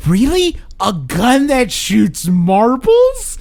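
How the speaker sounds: high, slow, disbelieving question; mock amazed, drawn out; incredulous. Quoting Paul Blart's incredulous reply.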